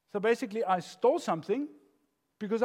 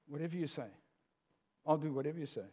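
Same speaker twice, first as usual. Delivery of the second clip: pleaser voice; 3 semitones lower, 6 dB quieter, faster than usual. Acted. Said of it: "the pleaser voice".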